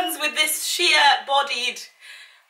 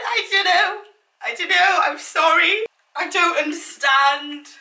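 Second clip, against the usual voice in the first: mock despair; 5 semitones higher than usual. Mock distress continues.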